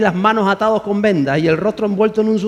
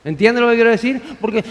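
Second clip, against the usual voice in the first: ordinary delivery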